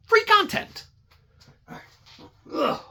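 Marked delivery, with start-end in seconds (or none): 2.5-2.8 high, disgusted ugh; disgusted groan. Real, not acted. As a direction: groan at something gross. Real disgust cleaning.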